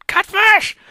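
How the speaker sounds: high loud shriek; sudden shriek. Acted. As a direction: shriek the word out. Mentor bellows enemy name.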